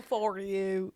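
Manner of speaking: "For you" drawn low and twangy; imitating "For you". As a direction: mimic the odd Texan "For you". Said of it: "so weird when you go".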